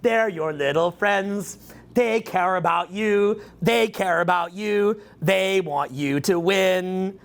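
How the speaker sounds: slow, syrupy mock reassurance; sarcastic lullaby. To his audience about their friends, a slow, sugary mock reassurance. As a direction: coo it like soothing a child, dripping sarcasm. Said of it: "I'm just kidding".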